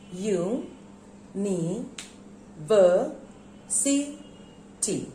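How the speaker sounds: UNIVERSITY as five isolated syllables with long pauses. Shown as a demonstration. Teacher counting syllables for pupils, separated. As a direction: say U, ni, ver, si, ti one by one. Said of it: "So how many syllables are there?".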